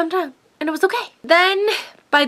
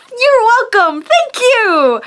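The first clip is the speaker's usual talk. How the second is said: excited reply; 10 semitones higher, 6 dB louder, slower than usual. Retold or imitated. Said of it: "And I was just like".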